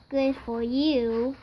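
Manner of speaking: usual speaking voice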